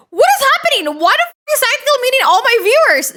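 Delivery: high, loud, fast disbelieving yell; shocked outburst. Real, not acted. Streamer yelling at chat about Tactical meeting viewers, high shocked outburst. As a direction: blurt it out in total disbelief.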